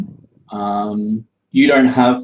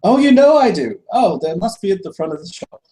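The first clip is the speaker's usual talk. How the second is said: high, louder, teasing shopkeeper; sly shopkeeper; 9 semitones higher, 5 dB louder, faster than usual. Acted. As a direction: tease him with a wink in the voice. Shopkeeper jokes back.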